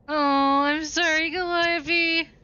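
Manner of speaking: high and loud, hammed-up sorry; high, loud mock-sorry; exaggerated apology. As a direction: gush an overdone apology. Apologising to the game character.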